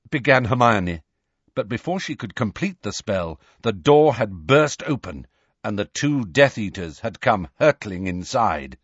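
usual speaking voice

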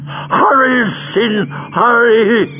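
high, strained, urgent croak; villain's pained, high-pitched urging. A narrator's reading. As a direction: croak the order in desperate haste. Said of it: "he croaked".

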